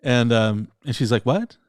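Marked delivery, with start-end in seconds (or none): 1.2-1.6 mock-baffled, jumping up high; puzzled question. Girlfriend to boyfriend who spoke French, retold puzzled surprise. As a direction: ask it as an imitated baffled listener.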